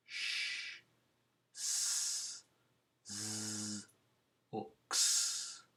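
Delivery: sh s z x sounds said on their own; isolated hissing sounds; sounds of the endings. Shown as a demonstration. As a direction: say each ending sound alone, breathy and clear. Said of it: "ends in ch".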